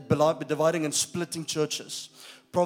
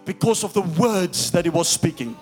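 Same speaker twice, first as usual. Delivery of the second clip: preaching at full force; 2 semitones higher, 6 dB louder than usual. Real, not acted. Preacher on Jesus speaking life, loud and emphatic. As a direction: proclaim it to the congregation, stretching key words.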